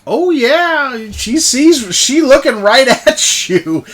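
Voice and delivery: high and loud, laughing through the words. Real, not acted. Game master delighting in players' surprise, laughing. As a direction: crack up while telling it.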